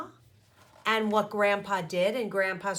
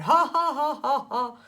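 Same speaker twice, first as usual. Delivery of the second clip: forced laugh; 4 semitones higher, 6 dB louder than usual. A narrator's reading. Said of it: "Grandpa laughed a little too hard".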